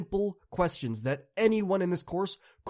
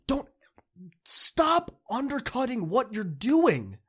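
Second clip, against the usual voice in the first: urgent whisper; 8 semitones higher, slower than usual. Real, not acted. Urging students not to undercut.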